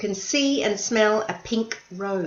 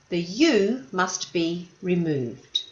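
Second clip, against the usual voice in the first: usual speaking voice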